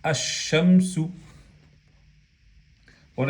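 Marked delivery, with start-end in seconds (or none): 0.0-1.1 "Ash-shamsu" slow, long doubled sh and no L; fused lam. Shown as a demonstration. Teacher answering her own question on alif lam, lam fused into sh. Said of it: "¿qué decimos?".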